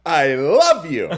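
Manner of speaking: slow, loud, overdone declaration; hammed-up acting. Acting on a viewer's suggestion, mock heartfelt. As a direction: act it out, stretching the words big. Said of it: "Just act".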